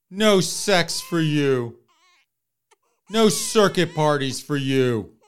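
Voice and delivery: slow hammy mock sobbing; fake crying. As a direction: sob it out in fake sympathy, drawn out.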